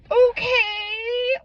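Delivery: a tearful okay that breaks; choked-up crying. Real, not acted. Girlfriend moved by his words, crying okay.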